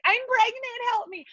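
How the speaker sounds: high, pleading mock cry for help; hammed-up high plea to the team; mock pregnant plea. Faking pregnancy to teammates.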